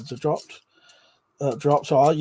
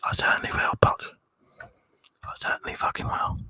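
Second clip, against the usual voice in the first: soft breathy whisper into the mic; hushed ASMR whisper for the stream chat; 3 dB quieter than usual. Pretend. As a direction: whisper like you're lulling viewers to sleep. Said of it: "Potch said, "[name] will give the A S M R people a big run".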